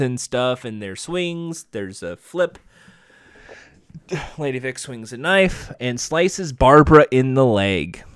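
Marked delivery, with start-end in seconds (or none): none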